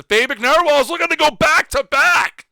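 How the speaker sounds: loud, high-pitched team hype; hyped announcer. Real, not acted. Hyping a team in the roll call.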